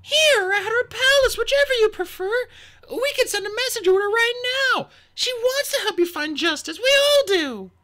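loud, high-pitched, pleading offer; a little desperate. A narrator's reading. Sunny urges the queen toward justice, a little desperately. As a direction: plead urgently to keep them listening. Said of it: "a little desperately".

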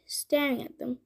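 plain reading voice

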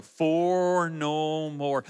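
very slow chant-like slogan with long vowels; comic slow-motion declaration; drawn-out motto. Real, not acted. Repeats the family motto for laughs.

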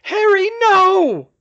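high, drawn-out scream; very high, loud, slow cry of horror; desperate scream. A narrator's reading. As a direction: scream it into the darkness. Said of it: "she screamed at the darkness".

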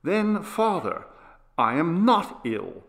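put-on mildly indignant boy, starting high; understated childish outcry; crying out in protest. A narrator's reading. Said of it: "he cried".

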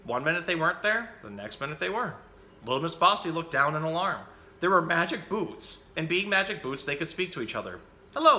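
put-on lively picture-book reading for children; animated bedtime-story reader; story narration. A narrator's reading. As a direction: read the story out with bouncy, emphatic phrasing.

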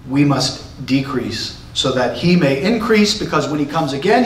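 normal talking voice

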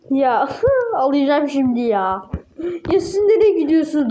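laughing while talking; words broken by laughter. Real, not acted. Laughing at the game.

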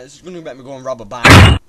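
fast, loud frustrated rant; exasperated rant. Real, not acted. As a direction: spit it out at speed.

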